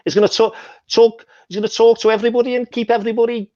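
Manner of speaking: high, fast Scouse footballer voice; Scouse impression. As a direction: rattle it off in a squeaky Scouse accent. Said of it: "Did you perfect that Scouse impression".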